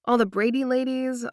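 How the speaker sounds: ordinary delivery, nothing marked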